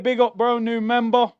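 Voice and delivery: usual speaking voice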